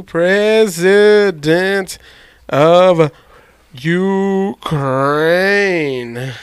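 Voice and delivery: drawn-out word by word; very slow, stretched out. Real, not acted. Typing a Google search, slowly word by word. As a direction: stretch each word as you type it.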